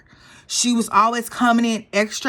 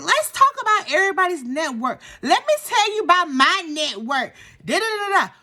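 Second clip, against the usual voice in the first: mocking Brynn; 8 semitones higher than usual. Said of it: "Brynn was like".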